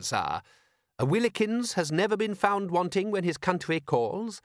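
high-pitched, proud manservant; eager patriotic butler. Acted.

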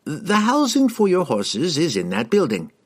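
high, breezy instruction; a cheerful host giving orders; wizard voice. A narrator's reading. Harkle voiced in narration.

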